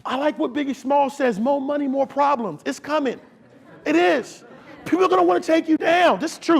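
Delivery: high, loud, emphatic sermon delivery; full-force preaching. Acted. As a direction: preach it hard to the room.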